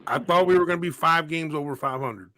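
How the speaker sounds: usual speaking voice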